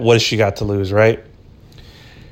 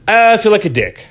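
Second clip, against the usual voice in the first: ordinary talk, nothing marked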